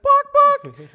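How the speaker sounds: very high, squeaky "bark, bark"; mock chicken. Giant chicken on heaven's throne answering the man, very high mock bark. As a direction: squeak it out like a silly cartoon chicken. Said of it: "He's like".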